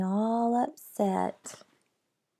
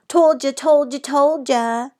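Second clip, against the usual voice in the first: taunting singsong; 6 semitones higher, 7 dB louder than usual. A narrator's reading. Mean Jim taunting, singsong.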